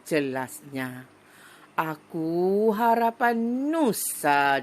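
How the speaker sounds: slow, loud declaiming of a poem; slow ringing poetry reading; poem recitation. Shown as a demonstration. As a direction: recite it slowly and proudly to the class. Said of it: "Nak dengar cikgu baca sajak ni tak".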